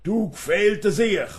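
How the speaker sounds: loud, high-pitched volley of insults; acted angry threats. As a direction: hurl playful-poem insults at full voice. (laut und hoch drohend, gespielte Wut)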